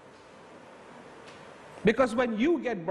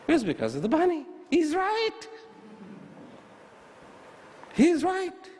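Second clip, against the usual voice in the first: higher, excited realization; lion suddenly sure, voice jumping up; excited realization by the fooled lion; lion convinced; 9 semitones higher than usual. Acted.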